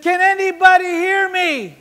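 high, pleading call into the crowd; Jesus calling out. Retold or imitated. Jesus on the cross in Times Square, calling out high. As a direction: cry out to be heard. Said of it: "He said".

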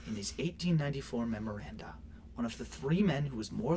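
hushed whispered reading; breathy whisper, self-restrained. Real, not acted. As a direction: whisper it to avoid yelling. Whispered after being told off.

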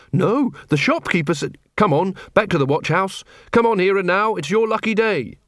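mildly upbeat officer talk; cheery constable. Acted. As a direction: chivvy the prisoner along kindly. Carrot talks to the unconscious thief.

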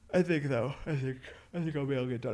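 talking through a yawn; words stretched by a yawn. Real, not acted. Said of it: "I'm sorry I'm yawning".

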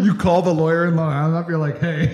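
loud, high-pitched mock phone pitch; excited joking. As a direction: riff it loudly, egging the other on. Excitedly riffing on calling the lawyer.

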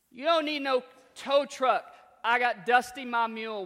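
loud, high farmer holler; country farmer. Retold or imitated. Farmer shouting to the coach about his mule, loud.

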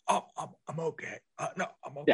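high, soft, strangled reassurance; mock choking, halting and high; embarrassed choker. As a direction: act embarrassed while choking and insisting you're fine. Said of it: "incredibly embarrassed that this is happening".

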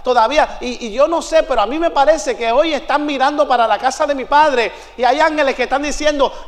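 ordinary talk

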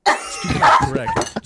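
squeaky high, slow sing-song; high silly falsetto. Pretend. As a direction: go way up into falsetto, slowly.

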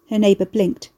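plain reading voice

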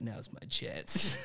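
slow whispered dog thought; sly dog voice. Retold or imitated. The dog seizing its moment, slow sly whisper. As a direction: whisper it slowly like a plotting dog.

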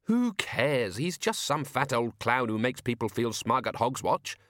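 indignant. A narrator's reading. Susan arguing back at Death about the task, indignant and sarcastic. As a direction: protest indignantly, pitch jumping up.

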